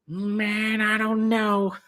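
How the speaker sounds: high, drawn-out laid-back dude voice; Greg impression. Friend imitating buddy Greg's sleepy voice, high and drawn out. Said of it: "That's the way he sounds, man".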